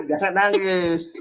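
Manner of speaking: says it through a laugh; chuckling jab at the debater; laughing tease. Real, not acted. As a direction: laugh while you mock him. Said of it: "Enggak lucu juga ya".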